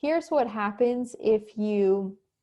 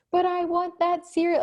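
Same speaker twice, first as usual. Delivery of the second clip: put-on high, louder whine of a thwarted kid; whining child; 9 semitones higher, 5 dB louder than usual. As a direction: escalate into a full childish whine. Child refuses the no.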